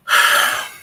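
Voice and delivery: loud, heavy exhale; heavy sigh. Real, not acted. Host has wasted it all on the floor, sighing. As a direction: blow out a big breath.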